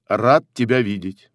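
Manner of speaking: ordinary talk, nothing marked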